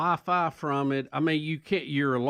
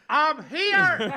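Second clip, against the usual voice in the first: high, small cry of I'm here; like Horton hears a who, I'm here; tiny faraway voice; 12 semitones higher, 4 dB louder than usual. Retold or imitated. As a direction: squeak it like a voice from far inside a purse. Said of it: "I'm going".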